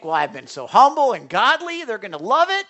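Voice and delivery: loud, self-satisfied boast; mock smug thought; smug self-praise. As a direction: pat yourself on the back. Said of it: "sometimes I think".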